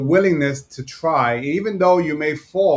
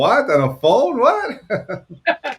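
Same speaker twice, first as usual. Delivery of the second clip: rising, laughing disbelief; mock disbelief; 4 semitones higher, 6 dB louder, slower than usual. Laughing mock shock at the phone-call complaint. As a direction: act stunned that anyone makes calls.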